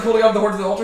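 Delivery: ordinary talk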